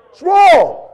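high, loud preacher's cry; full-force preaching. Real, not acted. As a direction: thunder it from the pulpit.

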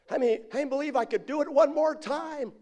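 high-pitched call to a crowd; showman's call. Retold or imitated. Rope walker testing the crowd, high showman's call. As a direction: play a performer working up onlookers.

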